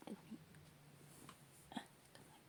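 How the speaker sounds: ordinary talk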